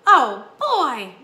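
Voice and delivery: loud burst of delight; excited exclamation. A narrator's reading. Young mouse allowed to go downstairs, excited. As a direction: exclaim it loudly, thrilled. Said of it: "exclaimed Ralph".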